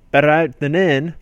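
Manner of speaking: loud, lilting Scottish accent impression. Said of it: "That's him doing a Scottish accent".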